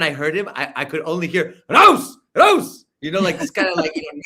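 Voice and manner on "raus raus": loud, high barked German shout; mock German guard shout; mock Nazi yell. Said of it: "yelling and screaming".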